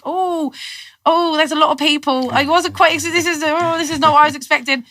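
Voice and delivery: high, startled exclamation at a crowd; taken aback. Retold or imitated. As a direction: gasp it out as the crowd surprises you. Surprised at the big crowd.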